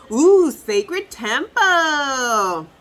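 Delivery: slow, loud cartoon minion voice; drawn-out awe as a silly minion. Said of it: "In my minion's voice".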